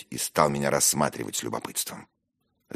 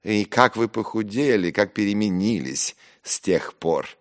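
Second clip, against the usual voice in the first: higher, louder mock sympathy; insolently pitying; venomous pity; 7 semitones higher, 5 dB louder than usual. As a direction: feign regret with a drop of venom. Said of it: "уж не без яду, с каким-то нахальным сожалением".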